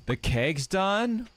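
slow, high, disbelieving; surprised high question. Real, not acted. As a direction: stretch it out in surprise. Surprised question about keg.

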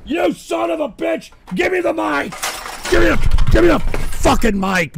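screeching high-pitched tantrum, loud; furious high shout. Acted. Radio host screaming at a prank caller, shrill furious tirade. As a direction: shriek it like a cartoon hothead losing it.

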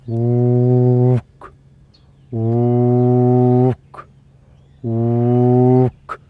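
long droning "ook" cut off by the tongue; low sound into K. Shown as a demonstration. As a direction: hold one deep note, then snap it shut with a K. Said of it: "gonna sound something like this".

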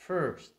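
"first" high, loud, punched on the vowel; stressed er. Shown as a demonstration. Teacher contrasting with the light sound, forceful stress. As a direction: hit "first" with a strong er. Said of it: "Còn cái âm này nó là".

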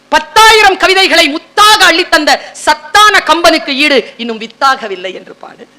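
grand, unhurried poetry recital; poem declaimed. Acted. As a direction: savour the verse in drawn-out phrases.

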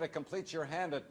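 ordinary talk, nothing marked

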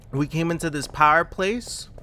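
ordinary talk

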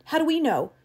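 high-pitched heated retort; agitated cry. A narrator's reading. Travis rejecting Lesperance's take it easy, agitated cry. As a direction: throw the question back, agitated. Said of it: "cried Travis".

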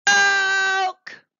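loud, high croak; the froglet finally makes a frog noise. A narrator's reading. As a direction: croak it out proudly.

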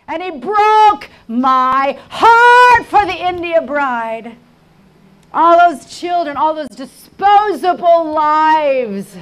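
high, loud cry from the pulpit; anguished, shouted sermon; impassioned preaching. Real, not acted. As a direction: preach it full force, stretching key words. Preaching at full force.

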